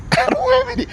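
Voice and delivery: words squeezed out through high laughter; laughing. Real, not acted. Vlogger on uncontrollable feed, laughing through words. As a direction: laugh helplessly while talking, pitch way up. Laughs while lamenting lack of control.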